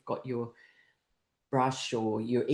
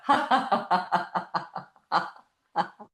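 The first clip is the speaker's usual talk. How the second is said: high laugh slowing to single puffs; long forced laugh petering out; milkshake laughter; 3 semitones higher, slower than usual. Shown as a demonstration. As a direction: gulp down a drink in laughs. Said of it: "it's called the milkshake laughter".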